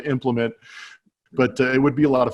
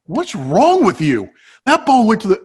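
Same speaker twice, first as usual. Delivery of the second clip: exasperated manager; 8 semitones higher, 5 dB louder than usual. Retold or imitated. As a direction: demand an answer, fed up. Manager confronting player, retold.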